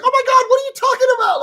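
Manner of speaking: fast, high, loud imitation of a frantic wife; alarmed shout. Wife hearing he stabbed himself, frantic high shout. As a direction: rattle it off in a shrill-pitched panic. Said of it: "She's like".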